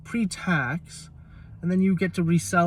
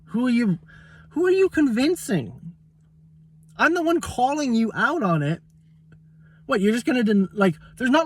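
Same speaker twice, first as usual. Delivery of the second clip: higher-pitched, incredulous challenge; exasperated confrontation; 5 semitones higher than usual. Retold or imitated. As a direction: call them out in rising exasperation. Acted confrontation with the seller.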